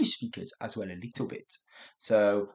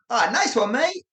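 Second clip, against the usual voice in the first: higher, loud and teasing; Australian banter; 12 semitones higher, 7 dB louder than usual. Shown as a demonstration. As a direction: toss it off like playful mate banter. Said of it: "did you hear how I said that?".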